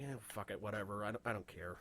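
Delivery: careless shrug. Retold or imitated. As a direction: toss it off like you don't care. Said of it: "I would just been like".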